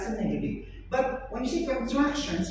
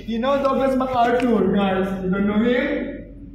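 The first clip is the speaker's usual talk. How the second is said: exasperated louder; 7 dB louder than usual. Real, not acted. Teacher exasperated at students.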